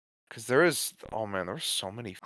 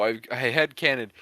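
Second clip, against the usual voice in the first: ordinary talk, nothing marked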